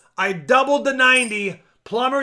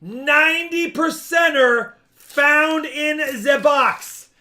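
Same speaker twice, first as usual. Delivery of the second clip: excited announcing; 5 semitones higher, 5 dB louder, slower than usual. Real, not acted.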